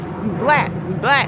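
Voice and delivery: loud jeering mock taunt; imitated taunt. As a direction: throw the insult out loud, mocking her. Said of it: "she kept saying that".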